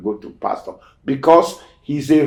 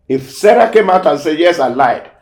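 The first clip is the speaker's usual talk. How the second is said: higher and louder, pressing the point; emphatic preaching; 6 semitones higher, 8 dB louder than usual. Real, not acted. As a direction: preach it with rising force. Preacher driving home a point.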